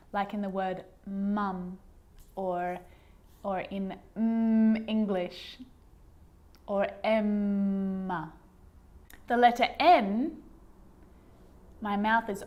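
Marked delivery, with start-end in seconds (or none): 4.2-5.6 slow, lingering "mm" on closed lips. Shown as a demonstration. Teacher showing lips touching all along, long held M. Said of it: "all of my lips are touching". 7.0-8.3 "Emma" very slowly, lingering on the mm; lips-closed M. Shown as a demonstration. Teacher giving an example word for M, drawn-out M. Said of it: "all of my lips are touching".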